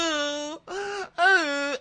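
high, hammed-up whimpers; mock crying. Imitating JoJo sobbing on the log. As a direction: fake sobbing coo. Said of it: "She's like".

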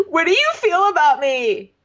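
high, pleading mock whimper; mock needy Chad. Mocking needy Chad to co-host, high whimpering plea. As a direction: plead like a needy drama queen. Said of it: "He's like".

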